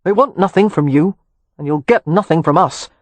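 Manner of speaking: loud, curt refusal; brusque. A narrator's reading. Kev refusing anything from his grandfather, brusque. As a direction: push the earl away with blunt force. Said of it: "Kev asked brusquely".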